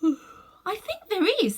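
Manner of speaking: put-on high, slow, worried girl; a gently pressing young girl; uneasy girl. A narrator's reading. Polly uneasy.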